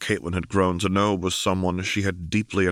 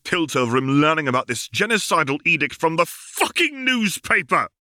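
angry; 10 semitones higher, 4 dB louder than usual. Acted. Caitlin furious at Jace.

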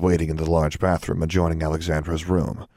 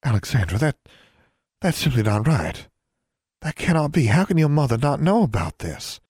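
higher-pitched, upset disbelief; appalled sister struggling to take it in; distraught; 8 semitones higher than usual. A narrator's reading. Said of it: "she sounded quite distraught".

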